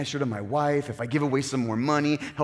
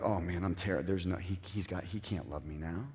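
despairing inner voice; 8 semitones lower, 12 dB quieter than usual. Retold or imitated. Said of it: "you start to feel like".

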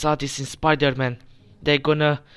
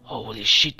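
usual speaking voice

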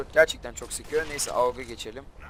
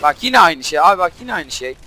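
louder, higher gamer complaint; exasperated; 4 semitones higher, 14 dB louder than usual. Real, not acted. Gamer protesting the same thing happening again, exasperated. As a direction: protest loudly at a repeat setback.

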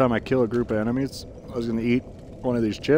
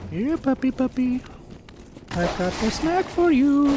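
coaxing pet voice; 11 semitones higher than usual. Pretend. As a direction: lure it in like a pet. Coaxing a monster with a chip.

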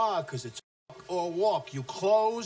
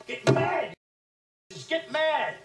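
much higher, loud yelled order; furious shout; 12 semitones higher, 5 dB louder than usual. Acted. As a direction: yell it to fire them up. Boss urges anger.

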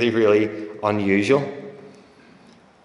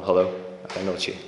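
soft phone greeting; 2 semitones lower, 6 dB quieter, much faster than usual. Retold or imitated. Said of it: "As we lift the phone".

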